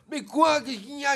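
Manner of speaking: high, very loud snap back; exasperated. Acted. Boy answering the classmate's endless prank, exasperated shout. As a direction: shout it, at the end of your tether.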